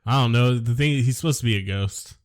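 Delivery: normal talking voice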